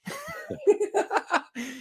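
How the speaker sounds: sudden high laugh; high laugh burst. Real, not acted. Host laughing at a tweet, high laugh burst.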